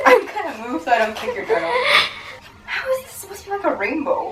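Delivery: high strained laughter; laughing. Real, not acted. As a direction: laugh through the effort. Laughing through workout.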